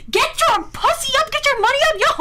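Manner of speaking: high, loud joking chant; high-pitched rowdy shout; mock hype. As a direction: yell it like a hype man.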